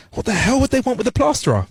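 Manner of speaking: high-pitched baffled question; amazed. A narrator's reading. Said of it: "shakes his head in amazement".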